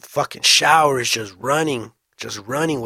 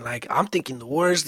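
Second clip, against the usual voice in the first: usual speaking voice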